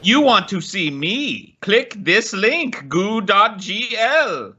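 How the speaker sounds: high, slow sing-song scam bot voice; scammer voice. Retold or imitated. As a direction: lure them like a spam message.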